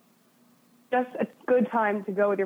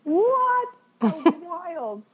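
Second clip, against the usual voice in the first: shrieking high with shock, then a tearful wobble; overwhelmed, thrilled disbelief; stunned disbelief; 9 semitones higher, 3 dB louder, much slower than usual. Retold or imitated. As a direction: gasp it out like you can't believe your luck. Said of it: "And I was like".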